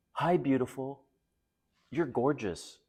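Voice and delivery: lightly hammed-up flatterer; fawning nice guy. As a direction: imitate a generic flattering message. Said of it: "this is how they message women".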